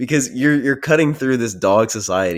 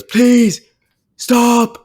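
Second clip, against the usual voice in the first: shrieked very high and loud; squealing plea, much higher and louder; high mock scream; 8 dB louder than usual. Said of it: "where he goes".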